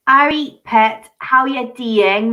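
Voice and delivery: slow, drawn-out Geordie accent; Rich. Shown as a demonstration. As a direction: demonstrate a Geordie greeting slowly. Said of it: "that's Richie's accent".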